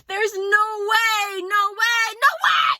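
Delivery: high, slow mock shout, rising to a yell; mocking Trump. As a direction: ham up his denial. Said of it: "he was like".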